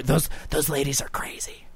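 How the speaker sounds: hushed aside whisper. Real, not acted. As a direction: whisper it behind your hand. Aside about the ladies.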